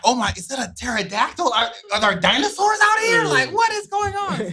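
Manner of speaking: hammed-up disbelief, rising and slowing at the end; mock bewilderment. Storyteller reliving the night in the bush, mock bewilderment. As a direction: pitch climbing high into comic alarm. Retold bewilderment at dinosaur-sized bird.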